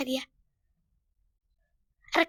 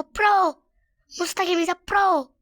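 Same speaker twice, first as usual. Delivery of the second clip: boastful cheer; 3 semitones higher, 7 dB louder, slower than usual. Real, not acted. Boasts, dictates praise for comments.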